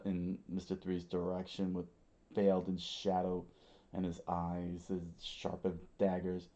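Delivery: ordinary delivery, nothing marked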